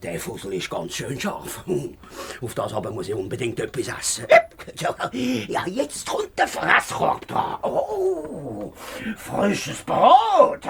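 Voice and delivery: smack your lips over the food basket; wolf voice. Acted. Wolf eats grandmother's basket.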